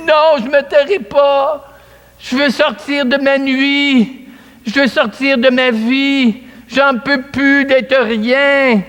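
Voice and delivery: high, slow, impassioned plea; desperate pleading. Acted. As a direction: refuse to be silenced, begging to change. Bartimée defies crowd, pleading. (supplication passionnée et aiguë)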